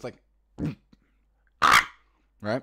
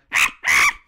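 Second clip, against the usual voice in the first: loud, lower strained hoot; low loud monkey grunt; 5 semitones lower, 13 dB louder than usual. Shown as a demonstration. Showing viewers how to set the tone, a loud grunt. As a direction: grunt it from the throat. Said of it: "get your tone like that".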